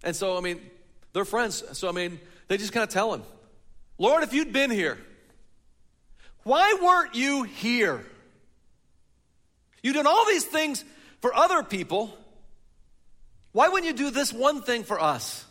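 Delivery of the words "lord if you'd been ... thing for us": high, loud, slow cry of hurt; anguished reproach. A narrator's reading. Sisters confronting Jesus over their brother, anguished loud cry. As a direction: throw the question at him in grief.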